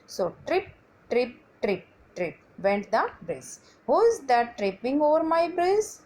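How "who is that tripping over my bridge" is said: roaring troll. Acted. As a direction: roar it at the little goat. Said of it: "roared the troll".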